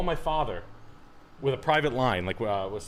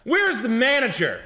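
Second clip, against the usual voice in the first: higher, louder Karen demanding the manager; indignant Karen; 11 semitones higher, 7 dB louder, slower than usual. Retold or imitated. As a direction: act the entitled customer, slowly. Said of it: "everybody would be Karening out".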